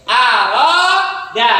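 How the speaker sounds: Aroda sung slowly, vowels held long; drawn-out recitation. Shown as a demonstration. Teacher demonstrating long vowel to students. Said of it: "Danya panjang pendek".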